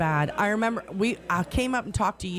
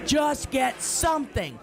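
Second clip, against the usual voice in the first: mock shout; 7 semitones higher, slower than usual. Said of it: "as he's telling me in the car".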